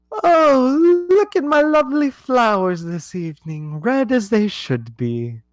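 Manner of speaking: high, loud, sing-song royal lady; a haughty queen admiring her roses; queen's voice. Acted. Game master voicing the Queen to the player, high and haughty.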